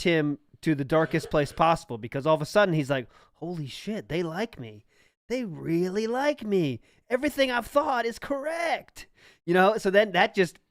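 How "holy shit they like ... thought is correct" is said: mock amazement building to high-pitched delight; awed self-delight. He imagines the YouTuber discovering fans like him, awed delight. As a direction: start hushed, climb high into giddy wonder.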